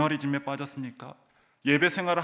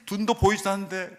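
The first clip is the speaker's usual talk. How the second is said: usual speaking voice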